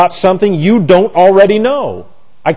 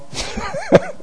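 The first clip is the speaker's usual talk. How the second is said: high laugh; 8 dB quieter than usual. Real, not acted. Laughing at mom's saying.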